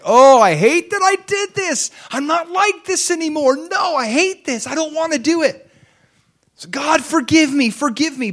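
very high, straining self-reproach, hammed-up; anguished remorse. Believer catching himself sinning, begging God, anguished remorse. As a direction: wail it high, imitating desperate regret.

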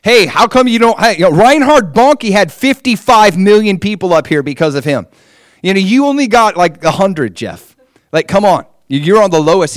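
a hammed-up heavenly scolding; mock scolding God. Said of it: "he's like".

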